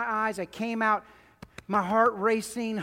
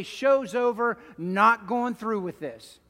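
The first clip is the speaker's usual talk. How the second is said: louder and higher, rising to a pleading shout; imagined outcry; 3 semitones higher, 6 dB louder than usual. Retold or imitated. Jesus imagining calling off the cross, pleading shout. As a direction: cry it out to the Father as if refusing the cross. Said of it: "He could pull the curtain down and say".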